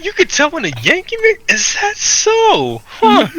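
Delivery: very high, drawn-out incredulous squeal; mock offended disbelief. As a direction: repeat the insult back in exaggerated high-pitched shock. Acted offended reaction to customer.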